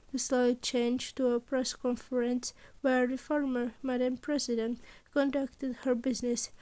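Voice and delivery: ordinary delivery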